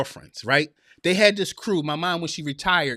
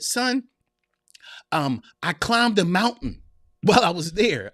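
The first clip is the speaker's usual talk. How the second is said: usual speaking voice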